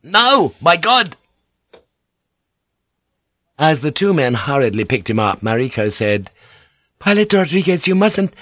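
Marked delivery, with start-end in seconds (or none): 0.0-1.1 high, loud angry exclamation; furious outburst. A narrator's reading. 7.0-8.3 high, urgent woman's plea; alarmed protest. A narrator's reading. Mariko protests as man is thrown.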